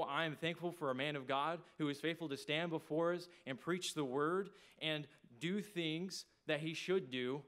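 ordinary delivery

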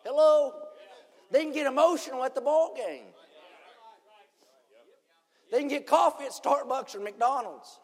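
loud, high preaching cry; preaching at full force. Acted. Preacher shouting to the congregation, full-force preaching.

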